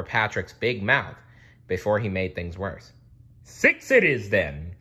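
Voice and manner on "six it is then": slow, loud command. A narrator's reading. King ending the bidding, slow command. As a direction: settle it with authority. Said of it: "commanded the king".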